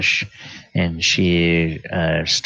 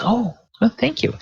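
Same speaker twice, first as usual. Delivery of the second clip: very high, breathy-sounding thanks... pitched way up; gushing half-elf; faster than usual. Acted. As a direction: flutter out a delighted thanks.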